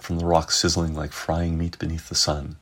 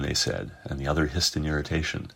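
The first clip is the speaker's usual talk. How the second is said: ordinary delivery, nothing marked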